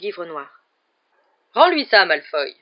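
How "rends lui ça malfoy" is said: sharp, severe raised voice; stern order. A narrator's reading. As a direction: order it with a prefect's authority. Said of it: "dit Percy d'un air sévère".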